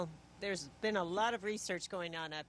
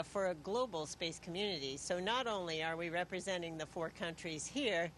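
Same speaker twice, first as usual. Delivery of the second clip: ordinary delivery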